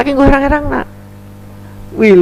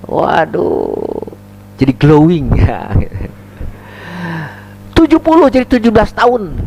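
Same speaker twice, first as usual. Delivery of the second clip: ordinary talk, nothing marked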